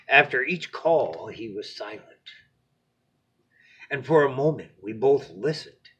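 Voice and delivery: lively storybook narration; expressive read-aloud to a little one; bedtime story reading. A narrator's reading.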